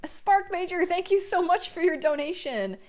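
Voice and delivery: high, loud, bubbly thanks. Real, not acted. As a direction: gush thanks to a donor. Streamer thanks a donor.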